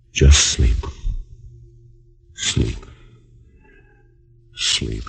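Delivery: slow, soothing hypnotist voice fading to a hush; hypnotic lulling. Acted. Hypnotist putting her to sleep.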